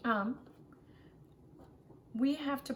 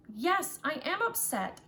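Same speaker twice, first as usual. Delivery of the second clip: put-on higher, louder pouty kid; upset child; 6 semitones higher, 4 dB louder than usual. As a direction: declare it like a sulking child. Child admits being upset.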